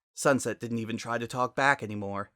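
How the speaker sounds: ordinary delivery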